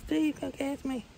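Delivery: high voiced muskie luring Steve; muskie calling out. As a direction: tease like a fish daring Steve to catch it.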